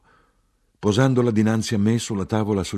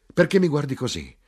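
higher, challenging cry; shouted question; 6 semitones higher, 4 dB louder than usual. A narrator's reading. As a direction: demand it in a raised, higher voice.

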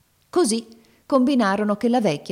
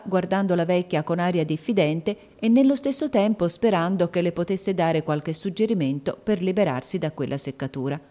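plain reading voice

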